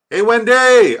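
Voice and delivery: high, loud hey Wendy; excited greeting. Real, not acted. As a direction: call out to a viewer with delight. Greets a chat viewer on stream.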